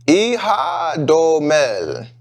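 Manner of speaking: Ihadomel sounded out slowly, syllable by syllable; slow, uncertain attempt at Ihadomel; careful tries at a name. Real, not acted. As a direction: feel your way through Ihadomel, unsure. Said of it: "Probably screwing that up".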